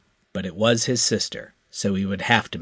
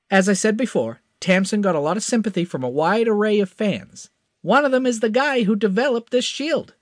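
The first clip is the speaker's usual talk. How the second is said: higher, confident hotshot; eager young pilot; 12 semitones higher than usual. A narrator's reading. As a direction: promise it with cocky eagerness. Crash voice.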